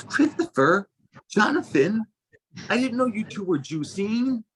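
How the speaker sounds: mom's quirky friend gushing in the juice line, high and sing-song; eccentric lady. Retold or imitated. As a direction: play the nosy, eccentric family friend. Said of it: "She's kinda, like, eccentric".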